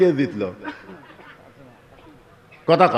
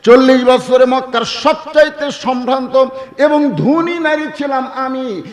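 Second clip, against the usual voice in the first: high, ringing proclamation; declamatory preaching; 8 semitones higher, 5 dB louder than usual. Acted. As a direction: proclaim it high, stretching out the ending. Preacher declaims Khadija's words. (উঁচু গলায় টেনে ঘোষণা)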